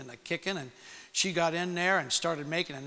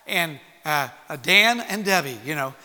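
usual speaking voice

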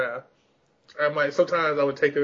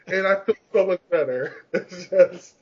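usual speaking voice

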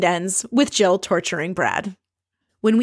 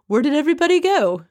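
higher, fast, whiny; mock lost husband; 7 semitones higher, faster than usual. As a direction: play the abandoned show-off. Husband alone on stoop.

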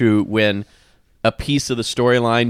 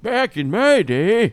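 put-on old man voice; 10 semitones higher, much slower than usual. Mocking grandpa nostalgia.

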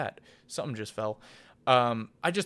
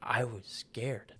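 emotional hush; 6 dB quieter, slower than usual. Real, not acted. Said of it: "I don't mean to get emotional".

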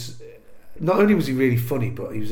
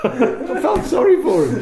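higher, louder, slow and laughing; 8 dB louder, slower than usual. Real, not acted. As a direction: still laughing as you say it. Laughing while telling the story.

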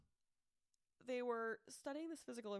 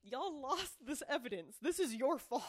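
raised-voice mock telling-off; indignant blaming; 4 semitones higher, 6 dB louder than usual. Podcaster blaming agents for lost evidence, indignant. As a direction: pile on the blame, louder toward the end.

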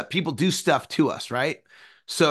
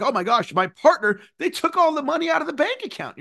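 upset friend; 9 semitones higher than usual. Retold or imitated. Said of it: "You're like".

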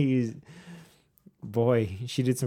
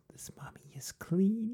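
high, slow, breathy ghost whisper; poltergeist voice; 8 semitones higher, 9 dB quieter, slower than usual. Pretend. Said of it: "the poltergeist voice?".

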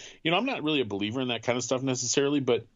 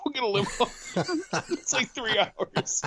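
laughing; 10 semitones higher than usual. Retold or imitated. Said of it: "he's like".

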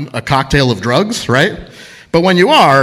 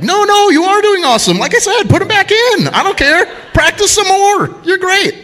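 very high, strained bravado; mock tough guy; 4 dB louder than usual. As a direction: overdo the bravado in a high, louder voice. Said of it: "I got all tough again".

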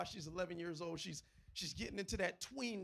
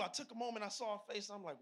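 ordinary talk

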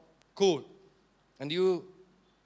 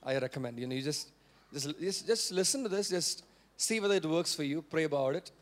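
low, hesitant, diplomatic suggestion; mild, filtered advice; 5 semitones lower, 3 dB quieter than usual. Acted. Preacher showing how he usually advises, low and tentative. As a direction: hedge it gently, as if afraid to insist. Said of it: "I get to speak like this only".

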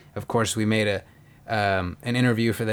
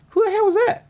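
high-pitched mock astonishment; incredulous retold question; 4 dB louder, faster than usual. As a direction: act out a friend's startled, high demand. Friend's startled question, acted in story.

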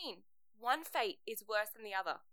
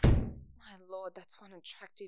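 dreamy sigh; 7 semitones lower, 7 dB louder, much slower than usual. Acted. Swooning aside after he leaves.

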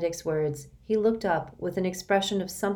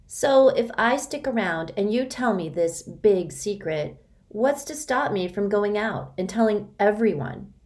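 put-on skeptical girl voice; 5 semitones higher, 4 dB louder than usual.

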